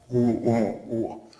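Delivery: normal talking voice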